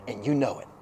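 low, soft tail of a sentence; quiet aside. Real, not acted. Preacher to congregation about claiming no credit, quiet aside. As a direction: drop KNOW IT down low and soft. First take, thrown away quietly.

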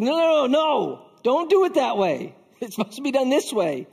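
high, mock-panicky correction; freaking out. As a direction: imitate yourself fussing over how it's done. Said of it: "I'm freaking out because somebody does something".

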